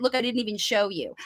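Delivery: ordinary talk, nothing marked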